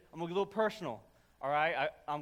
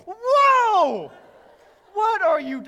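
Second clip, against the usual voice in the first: much higher, loud laughing yelp of pain; yelped whoa; 13 semitones higher, 15 dB louder, much slower than usual. Retold or imitated. Husband yelping at his wife mid-pluck, laughing loud cry. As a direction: jerk back from the sting with a laughing cry. Pastor recalls first painful pluck.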